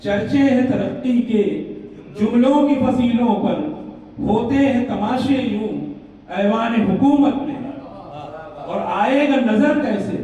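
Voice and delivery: forceful, projected mushaira recital; rhythmic short phrases, each punched then paused; Urdu verse declamation. Acted. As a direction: declaim each half-line to the crowd. Declaims verse to a cheering audience.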